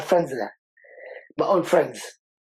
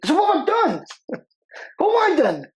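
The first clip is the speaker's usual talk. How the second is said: much higher, squeaky-voiced protest; high-pitched protest; 11 semitones higher than usual. Mock-baffled protest after friend's apology.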